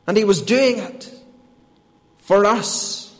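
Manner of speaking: high, forceful sermon delivery; impassioned preaching. Real, not acted. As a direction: preach it with mounting urgency, building high. Preacher builds to full force.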